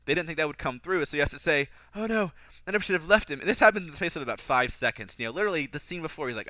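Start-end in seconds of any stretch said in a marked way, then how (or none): 1.9-3.4 high, loud, hammed-up regret; overplayed movie remorse; mock dismay. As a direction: lament it like a bad actor. Mocking Edmund's spoken-out regret.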